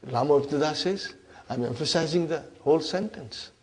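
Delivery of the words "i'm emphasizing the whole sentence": soft, mildly voiced timid answer; meek innocent character. Lam answers inna's challenge, meek and innocent. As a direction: answer softly, meaning no harm. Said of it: "uh, very innocent".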